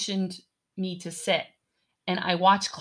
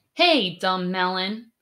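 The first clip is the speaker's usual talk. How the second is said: ordinary delivery, nothing marked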